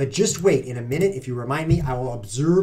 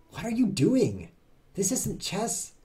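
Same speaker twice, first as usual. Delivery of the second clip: incredulous; 9 semitones higher, slower than usual. Real, not acted. Baffled by opponent's odd moves.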